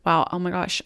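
usual speaking voice

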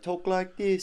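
high, prim posh English voice; posh British accent. Retold or imitated. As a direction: put on a fancy English accent. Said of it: "you're like".

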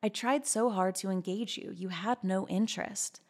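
ordinary delivery

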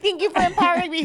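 high, loud, fast retold thanks; gushing mock gratitude. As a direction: gush it out quickly, pitched high. Retells gushing thanks to shouting man.

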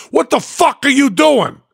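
high, furious demand; angry yell. Retold or imitated. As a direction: snap at the intruder. Angry biker confronts Penn.

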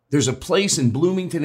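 ordinary delivery

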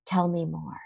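soft, unhurried invitation to talk; calm, grounded. Acted. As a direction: gently open the door for a worried child. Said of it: "someone who can calmly, in a grounded way".

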